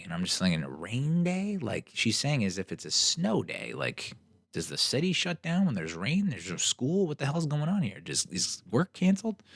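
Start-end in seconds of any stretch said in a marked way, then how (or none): 0.6-1.6 high, rising disbelief; incredulous. Retold or imitated. Reacting to the weather woman on the news, high incredulous question. 3.7-9.4 rising higher with each question; escalating disbelief. Real, not acted. Mocking LA's panic over rain, climbing disbelief.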